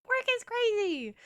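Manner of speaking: very high, slow mock-outraged squeal; mock outrage. Streamer reacts to chat calling her pork, mock outrage. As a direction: squeal it in drawn-out disbelief.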